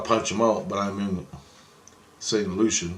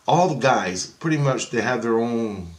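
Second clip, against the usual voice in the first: ordinary talk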